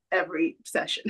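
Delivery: usual speaking voice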